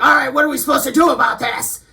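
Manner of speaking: loud, pushy demand; mock impatient shout. Impatient dudes demanding answers, mock shout. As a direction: bark it like an impatient guy. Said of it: "you're like".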